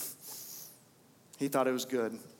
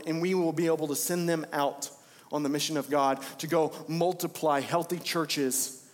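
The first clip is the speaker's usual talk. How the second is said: ordinary talk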